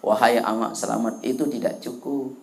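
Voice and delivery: low, soft, solemn address; God's verdict. Acted. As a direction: pronounce judgment quietly and low. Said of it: "Kata Allah". (suara Tuhan, rendah dan pelan)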